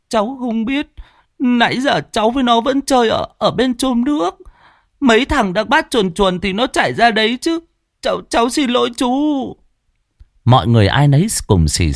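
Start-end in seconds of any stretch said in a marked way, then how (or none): 0.1-9.1 put-on high child's voice, near tears, rushing the apology; a frightened boy whimpers excuses to an adult; whimpering boy. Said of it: "nó cũng mếu máo". (giọng bé trai mếu máo, cao, nói vội)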